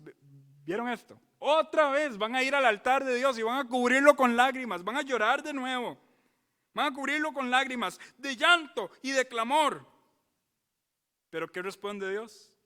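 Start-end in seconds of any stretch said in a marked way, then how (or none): 1.4-9.9 loud, high, pounding each phrase; fiery pulpit preaching; preaching at full force. Acted. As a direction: hammer the image of tears on the altar.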